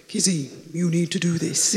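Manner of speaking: loud mock voice of God; big booming voice. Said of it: "not a big boom voice like".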